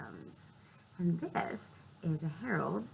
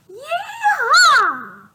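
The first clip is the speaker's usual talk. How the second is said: high, loud whooping yee-haw; cowboy yee-haw; 18 dB louder than usual. Acted. Piggy in cowboy getup cheering Gerald, loud whoop. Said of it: "And Piggy says".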